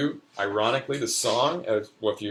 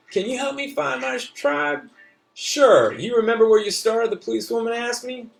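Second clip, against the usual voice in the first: put-on a young boy telling his adventure, pitched up; child's voice; 8 semitones higher, 5 dB louder than usual. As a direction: read it like an eager little kid. Child narrator reads his story.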